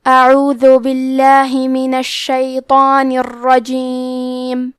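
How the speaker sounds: slow, drawn-out melodic tajweed recitation; Quran recitation. Shown as a demonstration. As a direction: chant each word slowly and evenly. Recites Quran verses slowly. (آہستہ، سریلی قرآن کی تلاوت)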